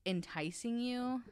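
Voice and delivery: ordinary delivery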